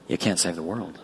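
Jesus telling Peter gently, soft and low; gentle Jesus. Acted. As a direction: say it softly and firmly. Jesus quoted speaking to Peter.

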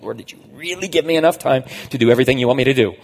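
loud, rapid questioning of God; exasperated plea to God. Acted. Preacher acts out his prayer about time, exasperated and fast.

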